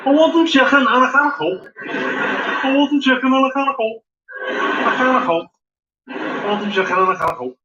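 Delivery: exaggerated mock Scouse; Scouse accent. Shown as a demonstration. Comedian drills his key phrase for the crowd, Scouse accent. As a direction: repeat it in a laid-on Scouse accent. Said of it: "to get me started in the counter".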